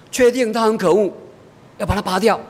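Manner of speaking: put-on high, decided kid voice; resolute child. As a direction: answer as a determined little girl. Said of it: "但是他很坚决".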